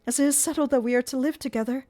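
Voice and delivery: put-on a frightened young woman begging; pleading girl. A narrator's reading.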